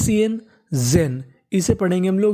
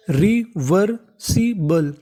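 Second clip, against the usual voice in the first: Reversible stretched very slowly, syllable by syllable; very slow sounding out; much slower than usual. Shown as a demonstration. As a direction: spell out reversible slowly as a teacher. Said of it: "तो पूरी meaning बन गई". (Reversible बहुत धीरे, अक्षर-अक्षर खींचकर)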